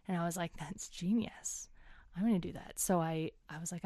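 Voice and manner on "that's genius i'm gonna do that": hushed scheming. Retold or imitated. Retold plotting her cake idea.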